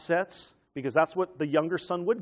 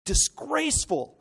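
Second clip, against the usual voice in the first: shocked religious leaders; 9 semitones higher than usual. Acted. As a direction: act out the leaders' stunned disbelief. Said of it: "and they're like".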